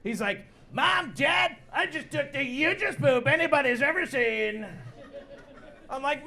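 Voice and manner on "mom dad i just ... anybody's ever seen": higher, boastful Trump shout; kid as Donald Trump. Retold or imitated. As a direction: brag to parents like Trump. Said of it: "like a Donald Trump".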